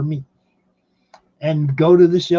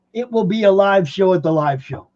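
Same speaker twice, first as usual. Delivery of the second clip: usual speaking voice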